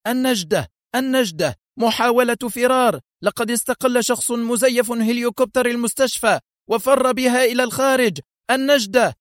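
high, urgent cry for rescue over the radio; a guard crying out for help into the radio; panicked call for help. Acted. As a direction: shout for help in alarm. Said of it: "وهتف".

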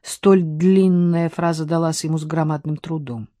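plain reading voice

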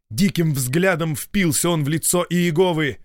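plain reading voice

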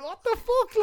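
sudden high-pitched disbelief; shocked what the fuck. Retold or imitated. To his mate who's blaming him, shocked squeal. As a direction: yelp it out, caught off guard. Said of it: "And I just kinda went".

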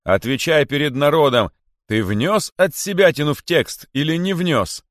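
loud, high demand; king's interrogation. A narrator's reading. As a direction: press him before the crowd. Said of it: "крикнул король". (грозный допрос короля)

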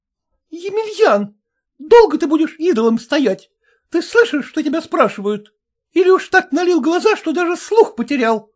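high-pitched scolding wife; lashing out. A narrator's reading. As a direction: go for the husband in quick, indignant questions. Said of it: "Опять набросилась на мужа Камышова".

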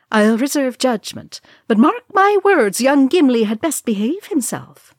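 put-on high, loud, stern old-lady warning; stern matron warning. Matron warns Thorin about Gimli, high and loud. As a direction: wag a finger at young Gimli.

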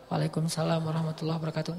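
answering the greeting barely above a breath; reply so softly he cannot hear; hushed reply. Retold or imitated. Companion answers the Prophet's salam very softly on purpose. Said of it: "saya pelankan suara".

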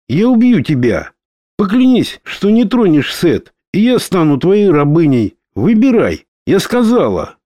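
fierce, high, determined; defiant. A narrator's reading. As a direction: threaten him with fierce resolve. Dora defies the Almighty.

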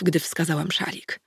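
plain reading voice

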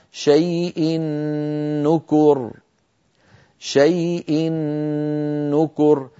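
شيء نكر بنون مشددة مع غنة; أدغم التنوين في النون; إدغام التنوين بغنة. Shown as a demonstration. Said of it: "المثال التنوين كما نرى على اللوحة". (إدغام التنوين بنون مشددة)

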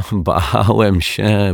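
drawn-out, slow relieved confession. A narrator's reading. Detective admits his fear to the children, relieved. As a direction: let it out slowly, with relief. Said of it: "odetchnął z ulgą".

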